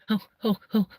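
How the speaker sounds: high-pitched, over-excited squeal of a celebrity impression; Stacey Solomon. Comic mimics a TV presenter thrilled with Joe, high excited gushing. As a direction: gush in a high voice with excited little ohs. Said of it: "why the fuck do I choose Stacey Solomon".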